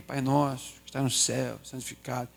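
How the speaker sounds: low, soft prayer, fading away; hushed prayer teaching. Retold or imitated. Mother teaching her son the Pai Nosso, low and hushed. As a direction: lead a child gently through a prayer, quietly.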